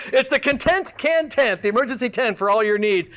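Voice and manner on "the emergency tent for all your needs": ordinary delivery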